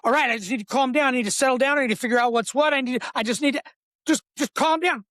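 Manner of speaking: talking yourself down while panicking; rushed anxious inner monologue; frantic self-talk. Retold or imitated. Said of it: "You cannot sit there and go".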